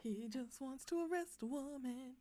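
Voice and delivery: sing-song mimicry. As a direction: imitate him in a little sing-song. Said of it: "The little sing-song voice".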